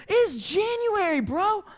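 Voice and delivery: high, loud, incredulous protest; exasperated outburst. Retold or imitated. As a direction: shoot up in pitch, scolding the roommate. Acted outburst at overheated roommate.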